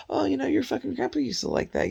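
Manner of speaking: high-pitched, airy put-on chat; mock fond reminiscing. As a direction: imitate someone casually sharing a memory. Voices casual sharing of memories.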